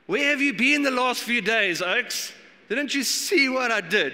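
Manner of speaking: where were you, didn't you see; exasperated. Retold or imitated. Pastor voicing Jesus to doubting crowd, exasperated and loud. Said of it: "I'm like".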